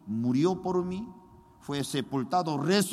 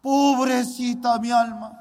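louder, anguished wail; loud lament; 3 semitones higher, 7 dB louder than usual. Retold or imitated. Boy weeping over his own soul, loud anguished wail. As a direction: cry it out in anguish. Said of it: "Yo lloraba mucho".

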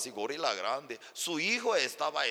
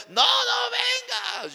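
high, loud, drawn-out mock fury; shouting; 7 dB louder, much slower than usual. Husband forbidding another visit, angry shouting. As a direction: shout it long to keep him away. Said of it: "Otra vez me gritaba".

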